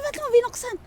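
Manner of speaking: imitating an exasperated older brother's tirade; brother's angry scolding. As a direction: replay the big brother yelling at you. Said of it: "Sinigaw-sigawan ako sa sobrang galit niya".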